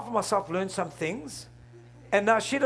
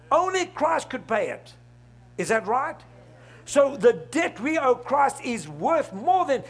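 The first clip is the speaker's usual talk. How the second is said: loud, rising preacher's cry; a fiery pulpit climax; full-force preaching; 4 semitones higher, 4 dB louder than usual. Acted. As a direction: drive it home to the congregation.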